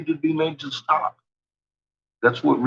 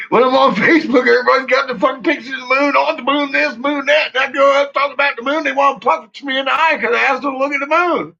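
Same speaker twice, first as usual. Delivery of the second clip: worked-up rant; 9 semitones higher, 4 dB louder, faster than usual. Real, not acted. Excited rant about moon pictures.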